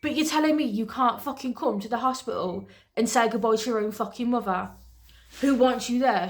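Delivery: usual speaking voice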